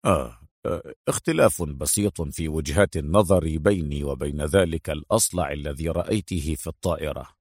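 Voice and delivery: low, soft, offhand reply; smiling understatement. A narrator's reading. Adham makes light of the shooting to Mona, smiling. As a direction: play it down with a smile. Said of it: "ابتسم أدهم وقال".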